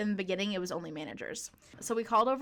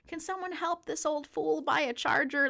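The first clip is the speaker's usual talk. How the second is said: a hint of an old man, pitched up; lightly voiced, higher-pitched old man; cute old man; 6 semitones higher than usual. Old customer asking staff for help, lightly voiced old man. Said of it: "this old man tries to approach".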